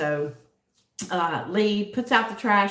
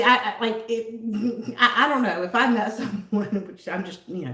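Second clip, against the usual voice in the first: normal talking voice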